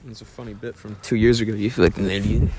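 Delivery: muffled by a mic in the mouth; microphone in mouth. Real, not acted. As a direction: talk around a mic pressed to lips. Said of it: "stop putting the fucking microphone in my mouth".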